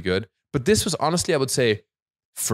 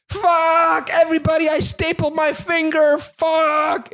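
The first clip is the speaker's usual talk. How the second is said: high, drawn-out scream of pain; screamed imitation; 4 dB louder, slower than usual. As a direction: scream it out to the whole room, stretching each word. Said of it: "he just screamed it around the restaurant".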